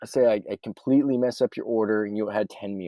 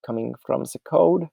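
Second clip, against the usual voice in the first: put-on playful German accent, pitched higher; 7 semitones higher, 3 dB louder than usual. Explaining spreadsheet formulas to viewers, in a playful German accent. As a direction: slip into a mock Bavarian accent, higher. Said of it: "I don't know why I'm doing German or Bavarian accents randomly".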